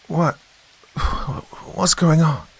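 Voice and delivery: high, groggy mother's voice; startled grumble. A narrator's reading. Mother woken in the night, high startled grumble. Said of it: "Sheila grumbled".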